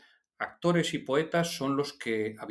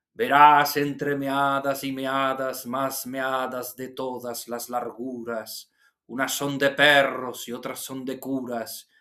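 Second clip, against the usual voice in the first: slow, cadenced, seafaring poetry recital; Rafael Alberti reciting. Retold or imitated. Teacher shows students how the poet recited, cadenced and drawn out. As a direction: draw out the verse in a lilting Andalusian manner. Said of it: "una manera muy marinera de decir los poemas".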